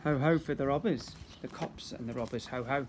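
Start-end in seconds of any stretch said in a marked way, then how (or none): none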